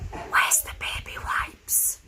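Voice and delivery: slow whispered question; whispering. Retold or imitated. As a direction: breathe it out slowly.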